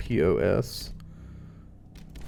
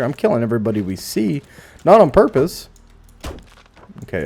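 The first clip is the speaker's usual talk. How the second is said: normal talking voice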